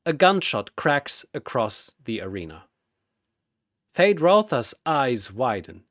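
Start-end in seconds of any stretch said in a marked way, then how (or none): none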